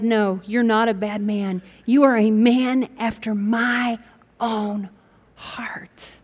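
slow, soft reassurance; slow down and comfort him; gently, as God to David; tender God voice. Retold or imitated.

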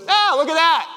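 high, cheerful exclamation; mock positivity. Friend looking at the bumper, imitated cheerful positivity. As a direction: play the untroubled friend marveling. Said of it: "now is not the time for positivity".

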